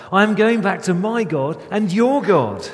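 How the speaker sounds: a loud, measured commanding voice; Jesus' voice. Acted. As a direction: speak it as Jesus giving a charge. Jesus speaks in the reading.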